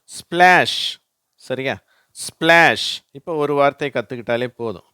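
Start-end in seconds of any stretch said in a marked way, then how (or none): 0.0-1.0 ஸ்பிளாஷ் high and loud, s-p-l joined; ringing model word SPLASH; SPL blend demo. Shown as a demonstration. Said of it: "அந்த வார்த்தை ஒரு உதாரணம்". 2.1-3.0 ஸ்பிளாஷ் high and loud, one onset; emphatic SPLASH for learners; SPL blend demo. Shown as a demonstration. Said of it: "அந்த வார்த்தை ஒரு உதாரணம்".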